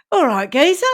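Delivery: put-on Cockney accent; mock Cockney. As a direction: play a fake Cockney geezer. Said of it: "it's Cockney".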